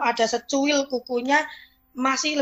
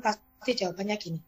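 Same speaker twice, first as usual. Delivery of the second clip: ordinary talk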